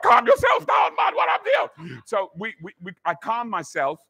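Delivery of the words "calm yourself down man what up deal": high, loud mock scolding; sharp telling-off. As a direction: bark it like a fed-up wife. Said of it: "But that's not what I got".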